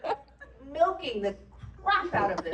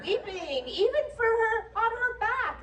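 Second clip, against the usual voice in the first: mock admiring crew; 8 semitones higher than usual. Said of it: "everybody thinks".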